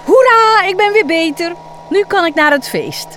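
very high, loud hooray; joyful cheer. Acted. Healed Michiel cheers about the party, loud joyful. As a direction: burst out in triumph.

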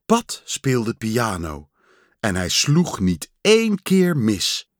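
ordinary delivery, nothing marked

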